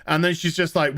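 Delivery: usual speaking voice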